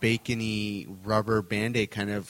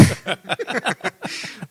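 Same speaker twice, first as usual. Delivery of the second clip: high laugh; 11 semitones higher than usual. Real, not acted. Laughing at leprechaun joke.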